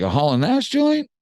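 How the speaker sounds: high, disbelieving repeat; surprised echo. Retold or imitated. He repeats the song title back in high surprise. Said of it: "He's like".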